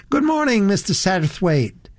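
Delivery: high, bright American greeting; high-voiced American girl. A narrator's reading. Elizabeth greeting Mr Satterthwaite, high girlish voice. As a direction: call out a high, girlish hello. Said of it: "a rather high, shrill voice".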